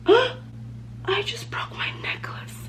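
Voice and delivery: sudden breathy whisper of dismay; real shocked hush; startled whisper. Real, not acted. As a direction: whisper it as something snaps. Necklace breaks mid-sentence.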